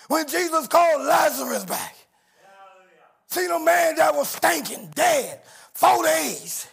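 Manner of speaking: fiery gospel preaching; preaching at full force. Acted. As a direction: build the crowd up, shouting praise. Preaching builds up.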